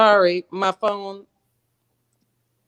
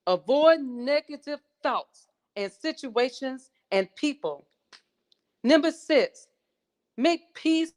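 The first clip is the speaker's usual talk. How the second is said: high-pitched, declaimed motivational list, falling at phrase ends; audition recitation; 6 semitones higher than usual. Acted. Contestant resuming her audition on the seven rules, high declaimed recitation. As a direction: proclaim each rule with spaced, emphatic phrasing.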